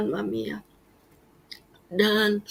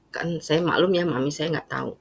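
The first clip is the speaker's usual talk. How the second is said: ordinary talk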